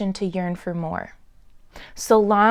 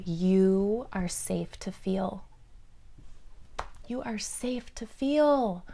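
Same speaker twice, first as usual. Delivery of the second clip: slow, soft and soothing; tender reassurance; 7 dB quieter, slower than usual. Real, not acted. Reader passes on the dream's message to listeners, softly and slowly reassuring. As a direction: reassure gently, taking your time. Delivers the comforting message, repeated.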